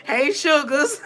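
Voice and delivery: normal talking voice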